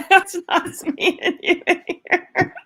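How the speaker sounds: speaking through laughter; slow, giggling words; laughing. Real, not acted. Laughing at stream mix-up.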